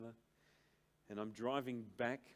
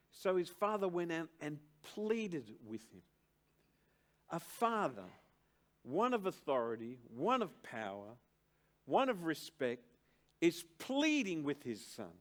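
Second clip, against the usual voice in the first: loud, raised, insistent sermon delivery; preaching at full force; 4 semitones higher, 6 dB louder than usual. Acted. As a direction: drive the definition home from the pulpit. Preacher exhorting about the father.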